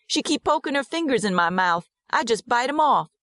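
put-on high little-boy voice; child's voice. A narrator's reading. Servant's son telling how he bites fingers, childlike. Boy Percy explains his biting.

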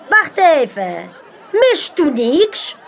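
high, slow, theatrical magician voice; mysterious magician. A narrator's reading. Magician Manolo invites the crowd, slow and theatrical. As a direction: lure the crowd in like a showman.

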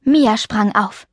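plain reading voice

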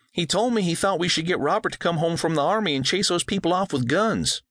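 put-on young girl blurting it out; earnest girl. A narrator's reading. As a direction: daughter repeating her brother.

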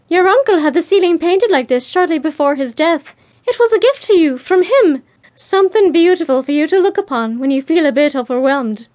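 high-pitched, enthusiastic character voice. A narrator's reading. Bea tells Jo of her uncle's gift, enthusiastic. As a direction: lift the pitch high and present it eagerly. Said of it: "Bea said enthusiastically".

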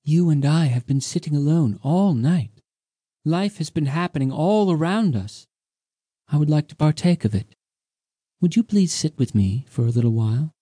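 young woman voiced. A narrator's reading. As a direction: speak as the mysterious dancer Undina, inviting. Undina voiced by narrator.

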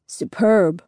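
a touch of mock grandeur; lightly put-on lofty dad voice. A narrator's reading. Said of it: "Daddy says in a lofty voice".

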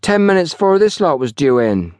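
high, sulky country lad. A narrator's reading. Young porter gives his boss the time, sulky. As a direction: add it grudgingly. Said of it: "said Wally sulkily".

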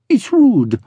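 high-pitched pouting woman; petulant, high little complaint. A narrator's reading. Pearl scolding Brigg's wandering hand, pouting. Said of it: "she pouted".

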